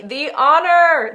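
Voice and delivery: belted, drawn-out swoon; gushing exclamation. Real, not acted. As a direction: stretch it out, overwhelmed. Overwhelmed by celebrity message.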